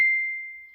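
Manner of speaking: short airless burst of laughter; breathless laugh. Real, not acted. Pastor laughing at the client's advice, breathless laugh.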